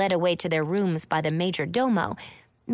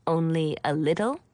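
put-on lightly accented prince, playful rising question; a touch of flirty teasing; mildly teasing; 3 semitones lower than usual. A narrator's reading. Prince fishing for more praise, lightly teasing. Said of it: "Prince Gunther asks teasingly".

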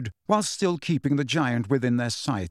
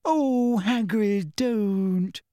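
higher, pleading moan; Hermione moaning; 7 semitones higher, much slower than usual. A narrator's reading. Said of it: "moaned Hermione".

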